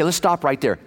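ordinary delivery

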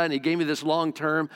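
ordinary talk, nothing marked

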